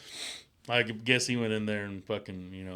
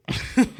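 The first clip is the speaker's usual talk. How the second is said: disgusted ugh; 10 dB louder than usual. Real, not acted. Narrator's disgust.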